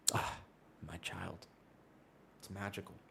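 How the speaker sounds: dreamy mom. Pretend. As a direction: hush it like a wise mother in a fairy tale. Mom voiced in mock story.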